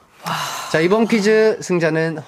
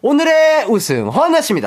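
hyped winner announcement; 9 semitones higher, 3 dB louder than usual. Acted.

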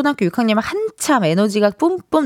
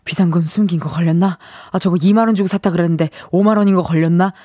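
nervous inner muttering; 4 semitones lower, faster than usual. Retold or imitated. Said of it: "이런 생각에 다들 긴장하실".

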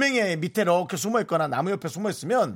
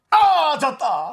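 laughing groan; 12 semitones higher, 9 dB louder, much slower than usual. Real, not acted. Losing the word game.

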